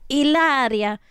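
Hilaria with a silent H, middle drawn out loud; affected Spanish-style pronunciation. Retold or imitated. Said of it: "I pronounce it how she pronounces it".

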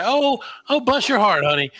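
high-pitched sugary Southern "Oh, oh, bless your heart, honey"; sweet Southern voice hiding contempt; Southern put-down. Retold or imitated. Imitating a Southern person dismissing an idiot, Southern accent. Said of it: "if you hear a Southern person say".

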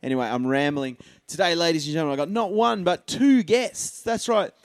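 loud, high showman welcome; podcast host intro. Acted. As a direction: open the show like a hyped host. Host intro after music.

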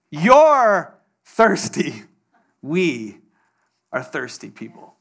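very slow, drawn-out pronouncement; slow emphatic declaration. Acted. Preacher turning the slang on the congregation, slow and drawn out. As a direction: lean on each word slowly.

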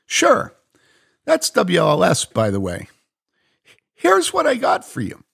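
sunny and quick; Mary, cheerful. Acted. Mary turns down the radio for the Israeli, cheerful.

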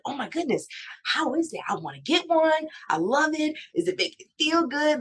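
high excited gush; fangirling. Retold or imitated. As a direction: gush like a starstruck fan. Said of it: "I'm fanning out all the way".

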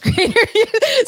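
breaking into laughter. Real, not acted. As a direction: laugh cracks the sexy read. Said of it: "I can't be sexy when you're making me laugh".

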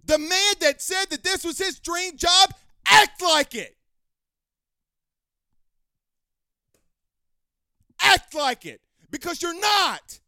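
high, loud demand. Real, not acted. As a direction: hurl it at him, real anger. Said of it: "raise my voice".

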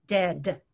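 dead with a hard D at each end; over-articulated dead; crisp consonants. Shown as a demonstration. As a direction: snap both D's of dead. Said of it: "work on the consonants".

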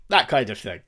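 ordinary delivery

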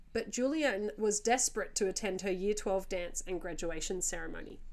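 usual speaking voice

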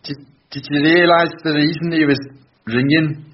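high-pitched amateur Scouse; bad Scouse accent. Podcaster attempting the last test phrase, bad Scouse accent. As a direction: try a Liverpool accent up high, haltingly. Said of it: "my awful accent".

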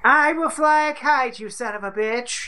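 a hint of a gruff Franklin; hammed-up founding father threat; playful Franklin voice; mock Benjamin Franklin. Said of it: "exactly what Benjamin Franklin sounded like".

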